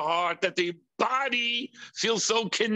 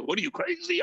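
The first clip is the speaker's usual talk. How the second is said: baffled onlooker; 12 semitones higher, much faster than usual. Retold or imitated. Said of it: "The person looks at you".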